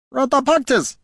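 very high, fierce little goblin squeal; high-pitched goblin war cry. A narrator's reading. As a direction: pipe it out fiercely, like a tiny goblin. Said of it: "he said fiercely".

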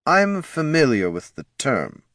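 cool, affronted marketing boss; frosty. A narrator's reading. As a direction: say it icily, drawing it out. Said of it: "said the head of marketing frostily".

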